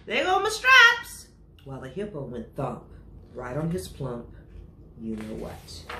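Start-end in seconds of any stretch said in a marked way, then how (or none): 0.1-1.3 high, loud panicked yell; zebra yelling. Acted. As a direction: shriek it, much higher and louder. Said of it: "The zebra yelled".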